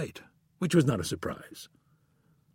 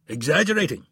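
sharp, louder "Exaggerating?" snapped back; snapping; 6 semitones higher, 4 dB louder than usual. A narrator's reading. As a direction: snap the word back in outrage. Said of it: "snapped Goldberg".

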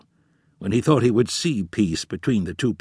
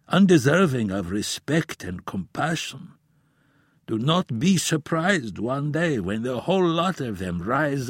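put-on grave old professor; 6 semitones higher than usual. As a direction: warn like a weary elder.

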